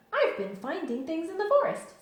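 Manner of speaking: loud, high, proud boast; importantly. A narrator's reading. Tigger boasts to Christopher Robin, loud proud voice. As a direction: puff up like the most important Tigger. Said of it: "said Tigger importantly".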